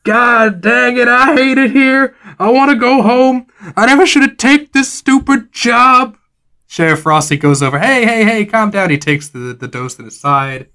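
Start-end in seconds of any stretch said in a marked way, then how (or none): 0.0-6.2 high pained whine of an injured docent; whining in pain. Acted. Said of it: "he's crying in pain". 7.8-8.9 soothing sheriff. Acted. Sheriff NPC calming docent.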